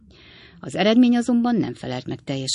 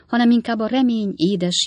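ordinary delivery

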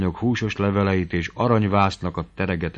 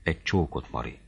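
soft, low, lightly breathless plea; hushed, understated lover's gasp; panting plea; 6 semitones lower, 9 dB quieter than usual. A narrator's reading. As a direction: beg under your breath, lovestruck. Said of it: "lihegte Noszti".

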